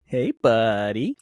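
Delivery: slow, stretched-out surfer greeting; lightly voiced laid-back Pauly Shore drawl. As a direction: drag out hey buddy like a stoner. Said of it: "Get a little Pauly Shore".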